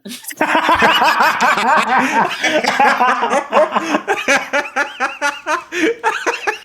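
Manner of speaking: bursting out laughing high; helpless loud laugh; loud laughter. Real, not acted. Laughs at own accent.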